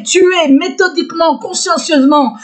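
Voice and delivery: ordinary delivery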